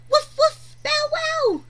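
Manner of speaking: high, loud playful barking; puppy bark. Acted. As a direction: bark like an excited little puppy. Voicing the puppies.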